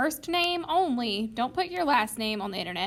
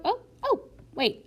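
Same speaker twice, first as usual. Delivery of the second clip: sudden remembering; 9 semitones higher than usual. Real, not acted. Suddenly remembers the secret question.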